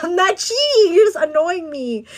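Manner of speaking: high, loud, laughing denial. Retold or imitated. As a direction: laugh it off as you deny it. Retelling her reply, laughing.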